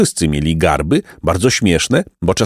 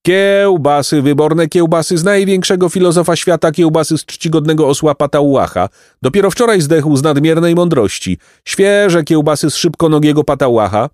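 bawling at full throat; 7 semitones higher than usual. A narrator's reading. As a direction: hawk the sausages to the whole street. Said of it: "darł się na całe gardło".

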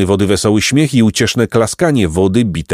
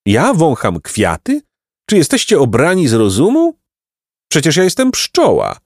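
indignant; 7 semitones higher than usual. A narrator's reading. Offended bee challenges the travellers.

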